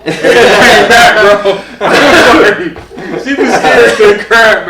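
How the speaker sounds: high, loud, slow words pushed out through laughter; cracking up while telling it; laughing hard. Real, not acted. To his co-host, laughing hard at the scared lady.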